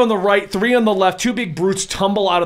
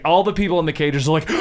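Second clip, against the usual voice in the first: ordinary delivery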